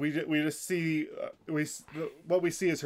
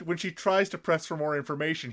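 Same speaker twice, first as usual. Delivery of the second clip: normal talking voice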